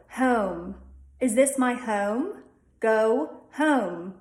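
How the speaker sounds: "home" said with an added schwa before a short u; "home" drawn out with an extra vowel; Delco home. Shown as a demonstration. Teacher shows "home" the Delco way. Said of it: "That is gonna change to".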